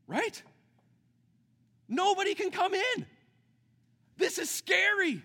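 loud, high, slowed-down preaching shout; preaching at full force. Acted. Pastor to the congregation on the Day of Atonement, preaching at full force. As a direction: build the question up and cry it out to the room.

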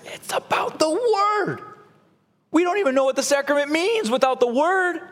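very high, strained mock outburst; acted sudden realization. Preacher voicing reformers who start to realize, excited outburst. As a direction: play an astonished discovery at a high pitch.

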